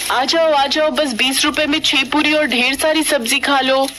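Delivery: mother hawking puri at the station; calling out. Acted. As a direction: call customers over from the stall.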